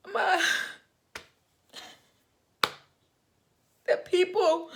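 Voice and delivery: high, tearful, laughing through tears; overwhelmed, voice cracking with tears; crying. Real, not acted. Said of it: "I'm trying not to cry".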